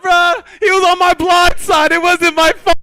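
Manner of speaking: high, loud defensive yell; loud excuse. Retold or imitated. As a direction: shout the excuse laughing. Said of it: "I'm like".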